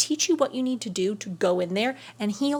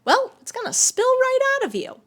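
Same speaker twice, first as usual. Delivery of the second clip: much higher, louder and dramatic; 13 semitones higher, 5 dB louder than usual. Acted. Teacher warning viewers about leaking gratitude, dramatically high. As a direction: sound the alarm playfully.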